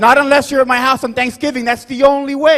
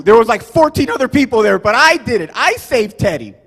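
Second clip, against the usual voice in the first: normal talking voice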